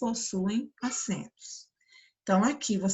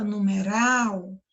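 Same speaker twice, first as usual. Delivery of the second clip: "numeral" com RAL mais forte; ênfase na última sílaba; much slower than usual. Shown as a demonstration. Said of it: "com mais força". ("numeral" marcando bem o RAL final)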